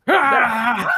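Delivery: high, loud wrestling roar; loud imitated yell. Game master acting out the boar wrestle, loud roar. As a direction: bellow like someone straining in a fight. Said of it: "He's like".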